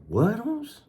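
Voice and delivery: high-pitched disbelieving rise; retold stunned reaction, pitch leaping up; incredulous question. Stunned by friend's going-home news.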